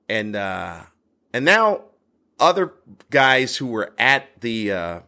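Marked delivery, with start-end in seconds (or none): none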